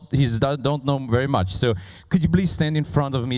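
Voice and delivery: usual speaking voice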